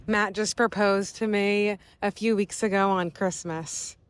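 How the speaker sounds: normal talking voice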